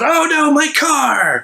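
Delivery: very high, slow wail of horror; hammed-up dismayed shriek; mock panic. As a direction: shriek it like your car just got ruined. Authority member sees car ruined.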